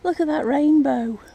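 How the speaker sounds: ordinary talk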